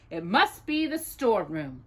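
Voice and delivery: ordinary delivery, nothing marked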